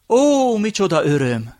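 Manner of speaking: high, drawn-out joyful exclamation; light up with delight, slow and loud; overjoyed. A narrator's reading. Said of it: "ragyogott fel a nagyapám arca". (örömtől felragyogva)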